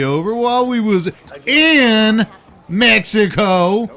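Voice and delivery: "in" drawn out long and slow before "Mexico"; heavy, stretched emphasis on "in"; stress on "in". Acted. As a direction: set "in" apart, slow and deliberate, then "Mexico". Insists on in, not about Mexico.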